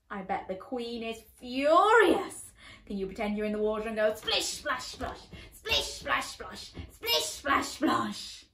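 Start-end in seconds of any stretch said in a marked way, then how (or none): none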